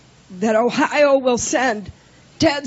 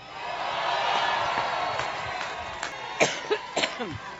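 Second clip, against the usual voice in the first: deep hacking cough; chesty cough; 3 semitones lower, 12 dB quieter than usual. Real, not acted. Coughing fit during speech.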